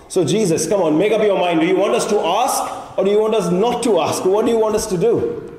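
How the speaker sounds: high-pitched, mock-baffled challenge; exasperated questioning. Believer asking Jesus to make up his mind, exasperated. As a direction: press for an answer, pitch climbing. Acted challenge over contradictory teaching.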